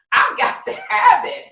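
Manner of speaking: eager craving. Retold or imitated. Telling the host about her morning prayer, with an intense craving. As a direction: belt out the need like you can't live without it. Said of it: "I'm like".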